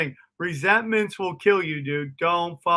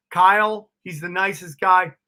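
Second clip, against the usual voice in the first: usual speaking voice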